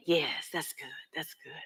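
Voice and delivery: faint, breathy and soft; barely able to speak from sickness; sick mother whispering thanks; weak whisper. Acted. Dying mother being rubbed.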